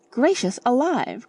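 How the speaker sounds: high startled exclamation; shocked cry. A narrator's reading. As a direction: gasp it out in shock. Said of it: "cried Missus Honeychurch".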